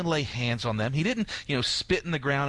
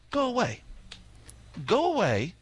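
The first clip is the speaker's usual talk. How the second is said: Jesus sending away; 4 semitones higher than usual. Retold or imitated. Priest voices Jesus dismissing lepers.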